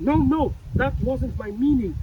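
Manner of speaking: loud, insistent denial; loud protest. Acted. Character loudly correcting the manager who misread him, insistent.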